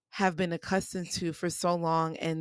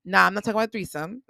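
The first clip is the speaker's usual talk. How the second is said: ordinary delivery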